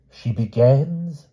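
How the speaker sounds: high, slow, teasing hint; coy hint. A narrator's reading. The king hinting at a name to knights, coy and slow. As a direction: drag out the clue like a riddle. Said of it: "said the king".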